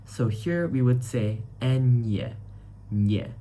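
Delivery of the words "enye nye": "enye" with a clear ny glide; Spanish ñ sound. Shown as a demonstration. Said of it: "like a N I E in English".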